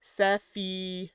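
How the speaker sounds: slow, tentative French-style Sephiro; French pronunciation. Shown as a demonstration. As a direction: try it as a French speaker, syllable by syllable. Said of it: "if I were speaking French".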